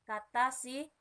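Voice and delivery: usual speaking voice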